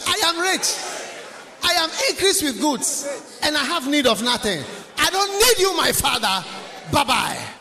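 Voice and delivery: high, loud, boastful shout; arrogant son. Retold or imitated. Prodigal son shouting to his father that he needs nothing, high and loud. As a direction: shout it like a proud rich son. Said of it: "what will be his word?".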